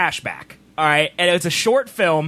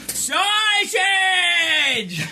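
put-on high-pitched, stretched-out old lady yell; 10 semitones higher than usual. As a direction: imitate an old lady calling her deli order. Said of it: "she points and she goes".

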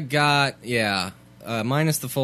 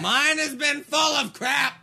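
exasperated outburst. Real, not acted. To his cohost about his awful week, a sudden exasperated high yell. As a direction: blurt it out, fed up.

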